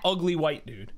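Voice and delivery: ordinary talk, nothing marked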